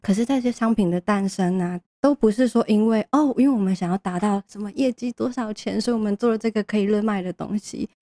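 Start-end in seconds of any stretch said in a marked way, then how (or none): none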